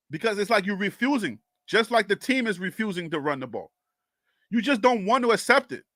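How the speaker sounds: normal talking voice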